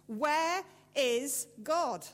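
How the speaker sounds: loud, slow and stern; preacher repeats his question, sterner. A narrator's reading. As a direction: drag out each word as a warning. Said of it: "in an even sterner voice".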